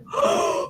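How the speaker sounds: sharp high gasp of dawning horror; horrified high gasp. Retold or imitated. Kid realising the coin must come out, horrified gasp. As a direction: gasp as if you just got it.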